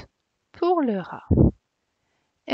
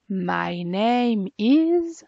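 slow modelled English; much slower than usual. Shown as a demonstration. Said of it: "teacher models English phrase".

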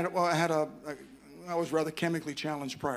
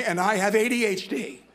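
louder and higher, stressed "A D H D" as a confession; mock confession to the group; 4 semitones higher, 5 dB louder than usual. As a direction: confess "A D H D" like at a support meeting. Mock support-group introduction.

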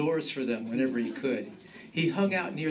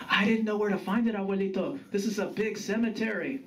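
higher-pitched grandson making excuses; defensive grandson; 9 semitones higher, faster than usual. Acted.

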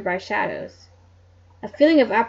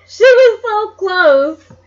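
high, loud, slow crying wail; frustrated wail; 10 semitones higher, 12 dB louder, much slower than usual. Real, not acted. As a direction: wail it in mock despair. Reader despairs at plot twist.

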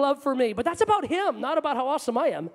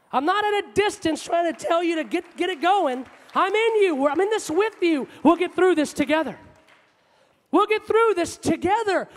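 forceful, raised declarations of commitment; impassioned preaching; 4 semitones higher, 4 dB louder than usual. Acted. Preacher voices God promising to stay, impassioned. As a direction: preach it with rising force, driving each promise home.

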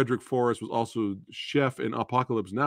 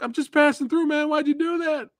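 much higher mock-hurt voice; innocent protest. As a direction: play it wounded and baffled. Acting the hero to golfer.

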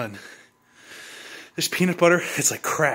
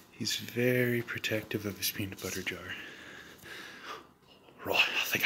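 whispering; 3 semitones lower, 12 dB quieter than usual. Real, not acted. Sneaking up on the dog.

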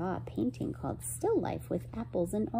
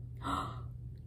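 quiet astonished intake of breath; soft gasp; 11 dB quieter than usual. A narrator's reading. Narrator marvels that paintings can be touched, soft gasp. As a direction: gasp softly in wonder.

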